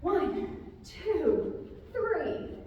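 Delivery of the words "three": usual speaking voice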